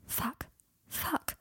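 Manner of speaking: very soft, stunned curse; hushed, separate curses to yourself; muttered swearing. A narrator's reading. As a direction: swear under your breath. Overwhelmed character swears to himself.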